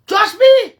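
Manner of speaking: high loud trust me; shouted trust me; loud insistence. Real, not acted. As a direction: hit it hard. Rant emphasis.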